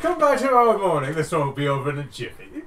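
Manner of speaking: high, loud, bubbly merchant voice; jolly clothier. Acted. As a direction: sell it like an eager tailor. Clothier NPC speaks.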